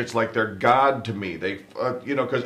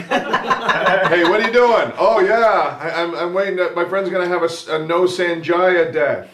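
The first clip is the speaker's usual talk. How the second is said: higher, louder mock eager bystander; put-on excited chatter; excited onlooker; 7 semitones higher, 5 dB louder than usual. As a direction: play a lightly hammed-up gawker. Acts out friend awaiting the death.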